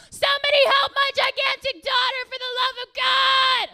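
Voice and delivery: high, desperate shout for help; yelling out. Acted. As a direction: melodramatic mom bellowing to the store. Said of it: "you kind of yelled out".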